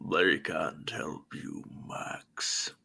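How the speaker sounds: slow, whispered villain threat; hushed, eerie monster whisper; Vecna voice. Acted. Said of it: "too much Sean Connery in there".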